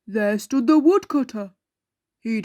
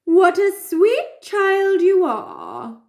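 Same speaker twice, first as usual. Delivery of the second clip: high, slow, sugary wolf flattery; butter her up slowly; sly wolf; 7 semitones higher, slower than usual. Acted. Wolf sweet-talks the girl, high, slow and sly. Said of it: "said the wolf".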